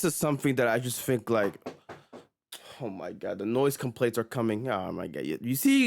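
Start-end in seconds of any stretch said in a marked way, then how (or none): none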